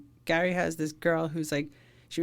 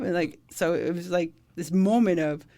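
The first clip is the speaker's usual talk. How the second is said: normal talking voice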